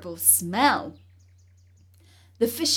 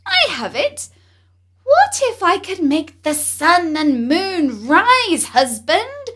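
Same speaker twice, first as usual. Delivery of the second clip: wife crying out her wish, higher and louder; a scheming queen crying out her demand; 5 semitones higher, 10 dB louder than usual. Acted. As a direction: proclaim it, greedy and grand. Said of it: "she cried".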